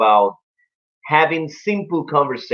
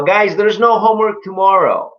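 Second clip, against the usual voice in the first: high, raised classroom announcement; teacher announcing; 8 semitones higher, 4 dB louder than usual. Acted. Teacher tells the class about homework, high announcing voice. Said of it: "the teacher says".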